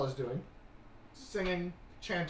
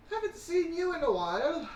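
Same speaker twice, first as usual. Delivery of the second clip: Mary's greeting; 6 dB louder than usual. Acted. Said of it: "as Mary is like".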